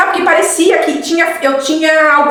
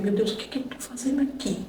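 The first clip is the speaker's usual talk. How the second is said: much softer, thinking-to-herself aside; private thought; 15 dB quieter than usual. Retold or imitated. Newcomer asking herself why she came, hushed private thought. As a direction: drop to an under-the-breath inner voice.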